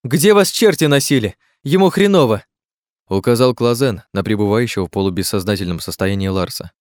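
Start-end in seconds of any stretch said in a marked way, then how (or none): 0.0-2.4 raised voice, pitched well up; agitated scolding. A narrator's reading. Companion rebukes late arrivals over the wounded man, agitated scolding. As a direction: lay into them in alarm.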